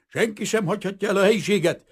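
high, loud, commanding shout; shouted order. A narrator's reading. Someone shouting orders in the darkened hall, commanding yell. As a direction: bellow a command over chaos. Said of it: "valaki ordított".

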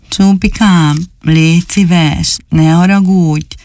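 put-on slow, gentle begging little boy; pleading doll voice. Dani begs the angry dog to forgive him, slow pleading. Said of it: "mondta Dani".

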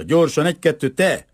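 calling out. A narrator's reading. Boy orders the frail Czech to go, calling out. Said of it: "kiáltotta".

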